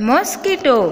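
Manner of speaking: mosquito pitched high for copying; teacher's high, careful mosquito; English pronunciation demo. Shown as a demonstration. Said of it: "અંગ્રેજી ઉચ્ચાર થશે".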